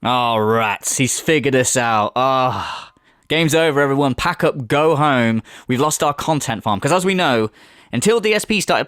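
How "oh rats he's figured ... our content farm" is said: mock defeated troll. Mocking trolls caught out.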